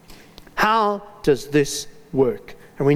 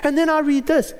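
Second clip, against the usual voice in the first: ordinary delivery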